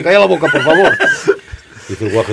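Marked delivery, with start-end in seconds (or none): 0.0-0.9 louder, higher desperate hush with a laugh; loud pleading hush. Acted. Lover hiding in the toilet begs the boy, loud pleading hush. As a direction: beg him to shut up.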